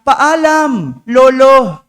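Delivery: put-on loud, high cry of goodbye from a dreaming child; sleeping boy shouting goodbye; shouted farewell. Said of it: "biglang sumigaw".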